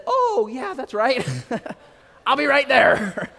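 high, loud sheepish laughing; embarrassed laugh. Retold or imitated. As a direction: laugh it off at your own mistake. Realizing his own mistake.

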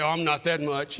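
low, soft bashful brush-off; mock modest reply. Man called a big dog, answering with mock modesty. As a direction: play flattered but humble.

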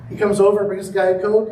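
usual speaking voice

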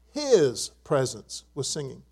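His drawn out, set apart before the rest; stress on His. Shown as a demonstration. Preacher reading the psalm to the congregation, His emphasized. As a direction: lean slowly on His. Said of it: "I'm gonna emphasize the word his".

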